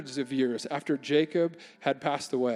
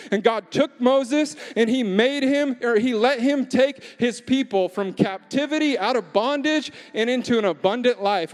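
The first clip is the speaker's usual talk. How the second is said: louder, higher climactic preaching; preaching at full force; 5 semitones higher, 4 dB louder than usual. Acted. Pastor rousing City Church, full-force preaching. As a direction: drive the sermon home to the crowd.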